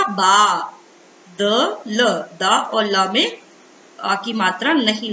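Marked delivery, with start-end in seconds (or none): 0.0-0.7 बा with a long, held aa; drawn-out syllable. Shown as a demonstration. Teacher showing children how बा sounds, drawn-out syllable. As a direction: stretch बा out loud and clear. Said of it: "इसका उच्चारण किस तरह से होगा".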